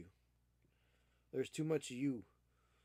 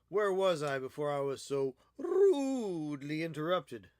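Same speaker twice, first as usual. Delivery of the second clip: louder, theatrical mock huff; mock indignant; 8 dB louder than usual. Painter mock complaining about his cat's interruption to viewers. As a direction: play the affronted lecturer.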